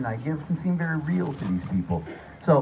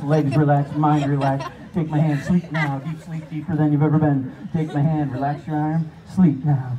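hypnotic induction; 3 dB louder than usual. Acted.

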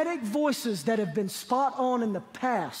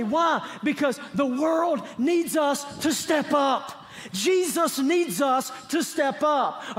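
raised, drawn-out preacher's call; preaching shout; 3 semitones higher, slower than usual. Acted. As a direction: preach it out to the congregation.

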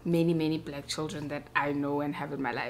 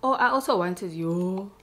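ordinary delivery

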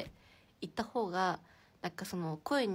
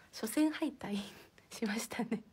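sheepish whisper through a small laugh; a hushed, embarrassed aside; slower than usual. Real, not acted. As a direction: whisper the confession with a giggle. Confessing an embarrassing loss.

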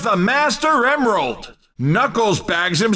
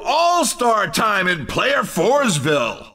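ordinary talk

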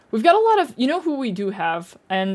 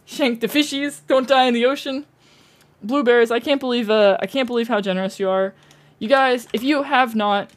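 usual speaking voice

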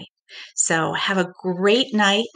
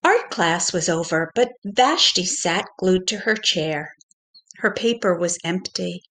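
gentle storybook narration for little ones; reading to children. A narrator's reading. Pre-K teacher reading a story to children, gentle storybook voice. Teacher reads picture book aloud.

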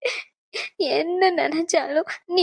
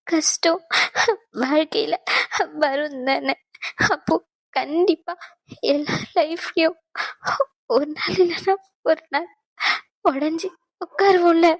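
halting, tearful confession; choked-up talk about breaking down. Acted. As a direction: push the words out through crying. She confides through tears.

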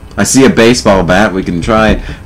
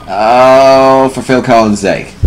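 ordinary talk

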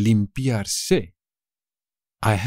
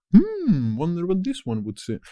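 musing aloud; 6 semitones higher than usual. Pretend.

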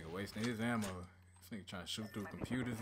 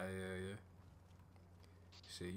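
normal talking voice

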